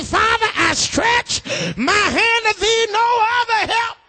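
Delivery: high, slow shouted plea to God; fervent prayer cry. Acted. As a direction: cry out to the Father. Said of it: "That side of you makes you say".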